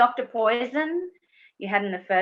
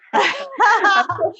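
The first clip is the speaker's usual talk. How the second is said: a short bright giggle; giggling at her own joke; high giggle; 13 semitones higher, 7 dB louder than usual. Real, not acted. Joke about cuddling.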